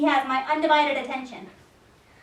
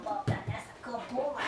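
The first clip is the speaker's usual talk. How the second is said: ordinary delivery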